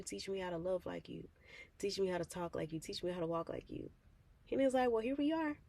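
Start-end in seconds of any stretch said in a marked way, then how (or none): 0.0-3.9 softer, pleading prayer; quietly praying to be changed; soft earnest prayer. Retold or imitated. Speaker quietly praying to God to be made more like him. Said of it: "saying all of those prayers".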